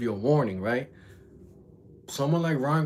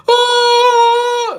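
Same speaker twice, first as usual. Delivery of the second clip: long, very loud high-pitched howl; exaggerated scream of pain; imitated pained wail; 17 dB louder than usual. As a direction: mimic a gut-punched kid crying out. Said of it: "the craziest thing I ever heard was the sound".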